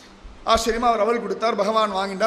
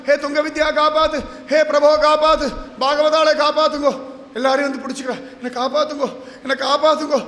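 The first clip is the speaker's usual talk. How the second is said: wailing plea; 3 semitones higher than usual. Acted. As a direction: beg desperately, hammering each repeated plea. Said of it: "கதறி எழுந்து ஓடுறா". (கதறி மன்றாடும் குரல்)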